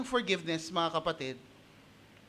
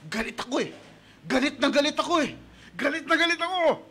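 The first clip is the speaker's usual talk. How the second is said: rising mock anger, higher and louder as it builds; mock angry outburst; 6 semitones higher, 4 dB louder than usual. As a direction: act out a hurt brother venting his anger. Angry brother voiced.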